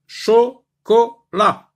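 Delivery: "Chocolat" slow, loud and high, syllable by syllable; three separate syllables. Shown as a demonstration. Teacher naming the picture for learners, "Chocolat" in three slow beats. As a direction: pause between each of the three syllables of "Chocolat". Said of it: "trois syllabes".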